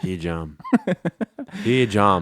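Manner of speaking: low mock Scottish accent. Host playing the jam-loving Scot, mock Scottish accent. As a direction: drop low and fake a Scottish voice. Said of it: "Is that a, a Scottish accent?".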